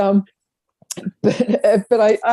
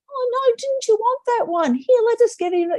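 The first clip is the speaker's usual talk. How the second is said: high, pleading parent voice; a frazzled parent giving in to the child; mock fretting parents. Parents caving to a screaming kid, high and pleading.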